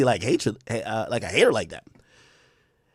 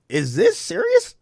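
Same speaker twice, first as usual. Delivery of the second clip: much higher, slow incredulous question; 14 semitones higher, 3 dB quieter, slower than usual. Retold or imitated. Host reacts to the diss verse, slow and incredulous. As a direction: stretch it out in disbelief. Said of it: "I'm like".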